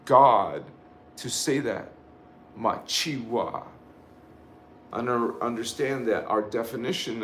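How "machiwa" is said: ordinary delivery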